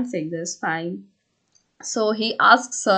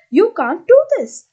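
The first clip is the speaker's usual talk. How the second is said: retold shout, much higher and louder; shouted protest; 11 semitones higher, 9 dB louder than usual. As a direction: cry it out as the exasperated heroine. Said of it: "she shouts".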